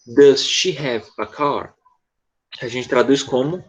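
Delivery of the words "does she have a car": slow teaching demo. Shown as a demonstration. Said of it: "a primeira coisa que eu coloco na minha cabeça, does".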